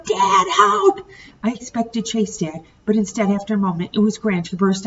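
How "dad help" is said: very high, louder cry for help; boy yelling for his dad; desperate call. A narrator's reading. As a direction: yell it in panic. Calling for help.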